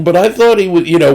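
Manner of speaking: normal talking voice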